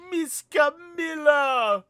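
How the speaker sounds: put-on high, loud, drawn-out child's scream; a small girl screaming as her friend is taken; childlike scream. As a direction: scream her name like a frightened kid.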